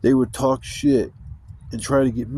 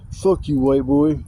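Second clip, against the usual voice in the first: mock taunt, punched a bit louder; hostile taunt; 2 semitones higher, 4 dB louder, slower than usual. Stranger taunting him as he walks by, mock hostile. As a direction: imitate a stranger throwing an insult. Acted street taunt from passing dude.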